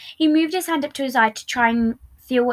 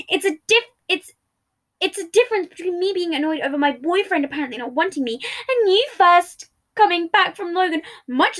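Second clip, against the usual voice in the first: higher, exasperated arguing; heated quarrel; 6 semitones higher, 4 dB louder than usual. Acted. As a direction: argue back, exasperated.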